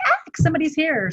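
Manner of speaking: high, flustered little yelp of surprise; mock startle. Live streamer spotting a first viewer, startled in mock alarm. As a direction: act suddenly caught out, pitch jumping up.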